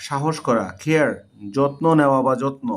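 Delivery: ordinary talk, nothing marked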